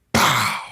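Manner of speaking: punchy "pow" for a landing hit; sudden burst mimicking a blow; imitated punch sound. Retelling hitting his father, a sudden imitated punch.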